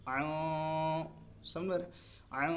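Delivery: عن with its n hummed through the nose; nasalised n; nasal ghunna. Shown as a demonstration. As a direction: let عن ring through the nose. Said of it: "ناک کے باسے سے آواز نکالتے ہیں".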